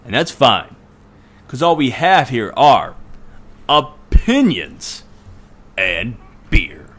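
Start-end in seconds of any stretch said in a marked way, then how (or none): none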